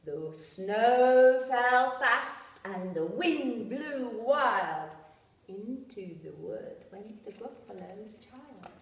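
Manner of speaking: dramatic storm refrain. A narrator's reading. Narrator reading the snowy refrain to children, slow sing-song. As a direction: stretch out the stormy words.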